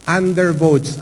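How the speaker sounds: normal talking voice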